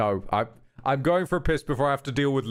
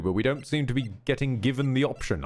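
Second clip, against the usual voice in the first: ordinary talk, nothing marked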